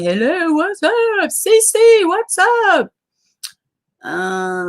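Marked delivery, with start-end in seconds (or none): 0.0-2.9 high, slow sing-song hello; bright sing-song welcome to a viewer; excited high greeting. Real, not acted.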